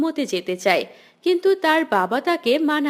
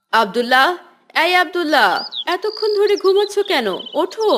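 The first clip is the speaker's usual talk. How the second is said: calling out. Acted. Parent calling sleeping son awake.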